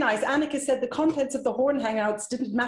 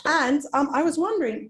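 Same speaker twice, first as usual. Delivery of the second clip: usual speaking voice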